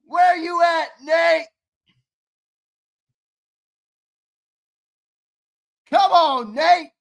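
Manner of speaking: loud high calls, first word drawn out; hyped shouting out a name; calling out. Real, not acted. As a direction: call the name across the room.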